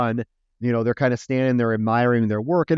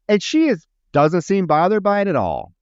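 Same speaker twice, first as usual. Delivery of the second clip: ordinary talk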